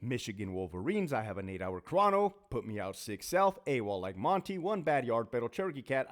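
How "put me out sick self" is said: usual speaking voice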